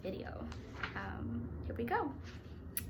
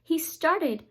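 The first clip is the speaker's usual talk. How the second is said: louder, lively storybook voice; reading to children; 2 semitones higher, 12 dB louder than usual. A narrator's reading. A reader reads Curious George aloud to children. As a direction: read it out bright for kids.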